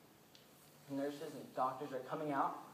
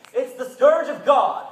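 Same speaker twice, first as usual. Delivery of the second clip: slow, loud thundering preacher shout, pitched up; fire-and-brimstone preacher; 7 semitones higher, 18 dB louder, slower than usual. Retold or imitated. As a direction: boom it out like a doomsday preacher. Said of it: "preachers on Tuesday are saying".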